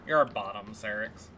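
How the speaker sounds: normal talking voice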